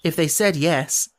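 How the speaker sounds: usual speaking voice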